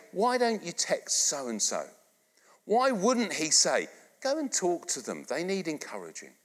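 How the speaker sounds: ordinary talk, nothing marked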